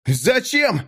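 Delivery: high, distressed outcry of "why"; high-pitched cry. A narrator's reading. Mik seeing Natan stabbed, crying out high. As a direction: cry out in dismay, pitch jumping up. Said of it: "закричал Мик".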